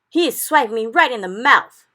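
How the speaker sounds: loud, high giant's protest; full-volume startled complaint; outraged yell. A narrator's reading. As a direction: yell out as if just struck. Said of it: "yelled the meat dripper".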